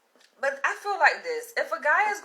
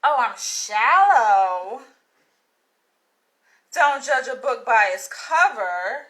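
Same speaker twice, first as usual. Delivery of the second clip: slow, louder sarcastic mimic of the commenter; mock the commenter, slow and louder; 8 dB louder, slower than usual. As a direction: drag it out to ridicule the comment. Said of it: "for him to say".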